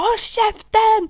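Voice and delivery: high, loud, slow scream; screaming shut up. Retold or imitated. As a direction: scream it at your friend. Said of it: "hon skrek liksom".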